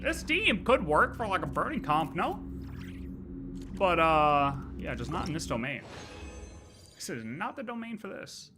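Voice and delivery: normal talking voice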